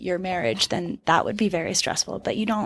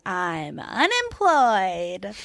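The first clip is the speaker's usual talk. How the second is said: mock dramatic announcement; much slower than usual. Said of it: "I was like".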